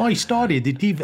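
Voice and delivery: high, badly faked accent; Emma's accent. Podcaster retrying a failed impression, hammed-up mock accent. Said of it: "I'll do my Emma accent".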